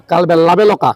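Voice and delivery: লাবেলকা, কালবেল উল্টো করে বলা; কালবেল তারপর লাবেলকা, উল্টো উচ্চারণ; backwards speech. Shown as a demonstration. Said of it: "উল্টো করে বলে দিতে পারেন". (উল্টো করে বলা শব্দ)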